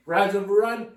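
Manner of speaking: "Rise over run" said loud, each word hammered; teacher stressing "Rise over run" loudly for the class; emphatic rule. Shown as a demonstration. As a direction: drill the rule into them. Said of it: "The reason I'm emphasizing this".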